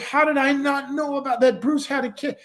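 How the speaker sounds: high-pitched retold disbelief; flipped out. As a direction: act out a man who can't believe he never knew. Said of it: "this guy really flipped out".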